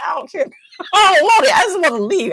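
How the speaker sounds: hammed-up frantic refusal; exasperated. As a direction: blurt it out desperate to leave. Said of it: "I'm like".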